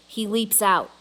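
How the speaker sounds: plain reading voice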